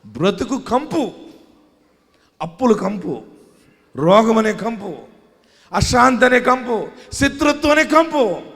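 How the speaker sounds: sermon list rising from steady to fervent; building preaching. Acted. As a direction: build the list to a pulpit peak.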